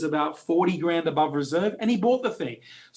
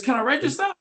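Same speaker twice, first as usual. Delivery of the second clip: eager bloke; 10 semitones higher, 4 dB louder, faster than usual. Retold or imitated.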